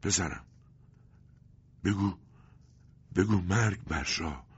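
dying words in slow fragments; broken, halting speech. A narrator's reading. Dying father urges his son, halting broken words. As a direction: gasp it out with long pauses. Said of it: "بریده بریده گفت".

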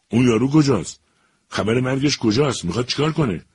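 high, loud angry demands. A narrator's reading. Finnish woman angrily demanding where the man is. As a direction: snap out each question in fury. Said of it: "با عصبانیت گفت".

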